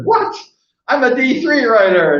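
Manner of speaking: put-on a teen caught off guard, protesting loudly; shocked protest. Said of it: "And I said".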